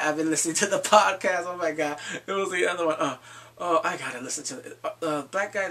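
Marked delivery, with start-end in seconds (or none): none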